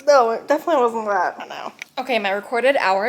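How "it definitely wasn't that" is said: ordinary talk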